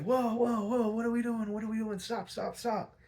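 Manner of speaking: hammed-up alarm to stop the drop; Penguin faking alarm. Penguin fakes panic over Batman and Robin, high and hammed-up.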